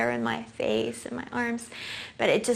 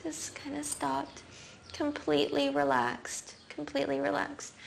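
fading hush; 6 semitones higher, 8 dB quieter than usual. Real, not acted. Child calms after crying.

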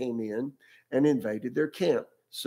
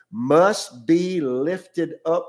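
very slow, louder, drawn-out lift of the phrase; emphatic; 2 semitones higher, 5 dB louder, much slower than usual. Real, not acted. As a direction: stretch the words out for weight. Preacher stresses key scripture phrase.

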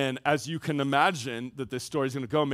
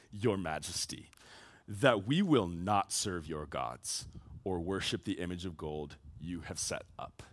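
much softer and lower, grave and deliberate; hushed solemn declaration; 8 semitones lower, 8 dB quieter than usual. Acted. The three men answering King Nebuchadnezzar, hushed and grave. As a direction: lay it down quietly like a final vow.

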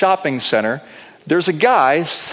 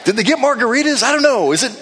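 curious wife; 9 semitones higher than usual. Retold or imitated. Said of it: "Tracy's like".

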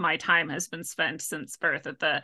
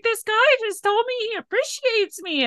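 much higher, amazed retelling; retold amazement; 3 dB louder than usual. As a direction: tell your partner, squealing with disbelief. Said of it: "I was like".

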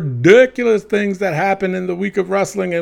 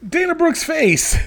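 laughing; 3 dB quieter than usual. Real, not acted. Laughing while reading item.

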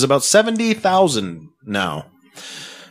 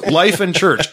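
ordinary talk

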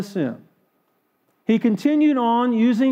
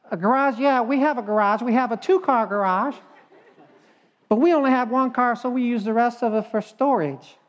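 put-on higher, cheerful older woman's voice; chirpy old lady; 4 semitones higher, faster than usual. Older lady tells her attorney about her land, sweetly clueless.

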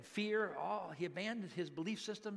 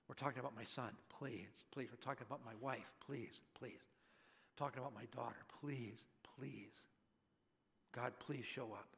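low, soft begging, whispered in places; hushed pleading prayer; 6 semitones lower, 11 dB quieter than usual. Acted. Parent praying for son, wife and daughter, hushed pleading. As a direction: plead quietly with God, voice dropped right down. Acting out prayer for one's family.

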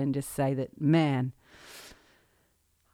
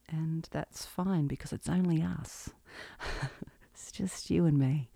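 hushed confiding whisper; soft real whisper, nervous and intimate; whispered aside; 7 dB quieter than usual. Real, not acted. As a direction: let it slip out as a secret between us. Confiding just us, scary podcast.

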